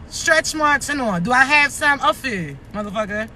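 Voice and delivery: usual speaking voice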